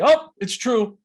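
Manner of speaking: bright, loud, high mock exclamation; neighbor's exclamation. Neighbor answering a sneeze with a high, loud exclamation. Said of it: "she would say".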